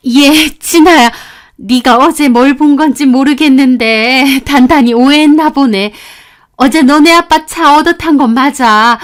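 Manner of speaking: smooth it over, a little too loud; teacher explaining away. Retold or imitated. Teacher excusing herself to the student, high and loud.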